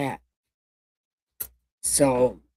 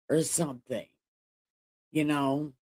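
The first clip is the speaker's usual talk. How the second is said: usual speaking voice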